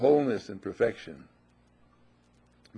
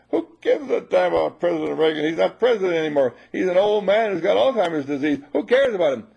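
scoffing objector; 5 semitones higher, 6 dB louder than usual. Pretend. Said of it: "voicing a scoffing objector".

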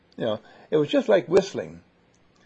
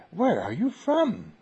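higher, drawn-out mock wonder; imitated intrigued question; 5 semitones higher, slower than usual. Charmed Americans asking Ellen where she's from, imitated as intrigued. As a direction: stretch it out as a marvelling listener's question.

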